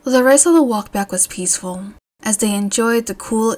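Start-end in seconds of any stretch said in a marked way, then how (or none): none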